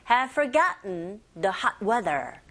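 slow, measured English phrase; slow pronunciation model. Shown as a demonstration. Teacher leads repeat-after-me practice, slowly. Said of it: "따라해보세요".

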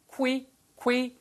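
'qui' with a clear kw, high and separated; exaggerated model of the w in 'qui'; careful w sound. Shown as a demonstration. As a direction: round the lips into 'kwi', repeated. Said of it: "발음 하나 주의하세요".

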